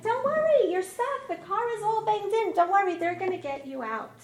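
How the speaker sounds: high, loud, insistent comforting; calling out comfort to someone stuck; urgent reassurance. Retold or imitated. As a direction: start high and loud, settle as you reassure. Calling reassurance to trapped husband.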